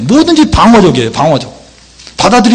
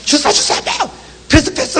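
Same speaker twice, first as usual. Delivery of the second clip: high, light-volume mock soccer calls; urgent player's calls, imitated softly; calling for the ball; 10 semitones higher, 7 dB quieter than usual. (축구 선수가 다급히 외치는 흉내)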